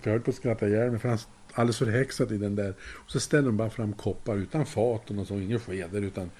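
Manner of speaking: ordinary talk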